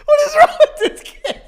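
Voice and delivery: loud and high, laughing through it; laughing shout. Real, not acted. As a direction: exclaim in helpless laughter. Laughing at friend.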